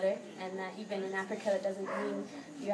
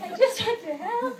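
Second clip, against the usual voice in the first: high, louder, laughing through it; laughing plea; 13 semitones higher, 6 dB louder than usual. Retold or imitated. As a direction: plead to help while giggling. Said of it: "I'd be like".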